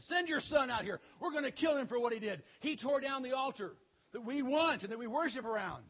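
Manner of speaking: furious townsfolk yelling threats; angry mob. Acted. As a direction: pound on the door and demand. Angry mob at Joash's door.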